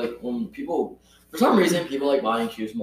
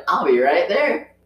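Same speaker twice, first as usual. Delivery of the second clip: slow, higher, sweet Southern drawl; nice Southern voice; 5 semitones higher, 4 dB louder, slower than usual. Retold or imitated. Manager answering the cashier's call, nice Southern voice. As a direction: imitate a nice Southern lady, drawn out. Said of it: "Her southern, nice voice".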